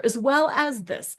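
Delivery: ordinary talk, nothing marked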